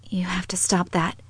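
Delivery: hushed, airy whisper. A narrator's reading. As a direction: whisper it as a quiet plea. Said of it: "she whispered".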